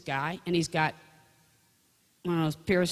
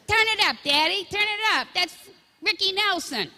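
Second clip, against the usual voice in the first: put-on high, loud whiny kid pleading; begging child; 14 semitones higher, 6 dB louder than usual. Child in the back seat begging Daddy to turn up the radio, high loud whine.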